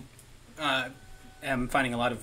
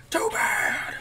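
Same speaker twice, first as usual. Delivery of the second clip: very high, louder squeal; teasing pet-talk; 5 dB louder than usual. Real, not acted. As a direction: tease the dog in a squeaky voice.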